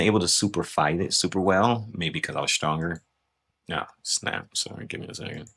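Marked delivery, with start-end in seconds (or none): 3.7-5.5 breathless whispered aside off-mic. Real, not acted. Fumbling the video mid-review, whispered apology. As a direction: murmur the apology under your breath. Mishap aside while recording.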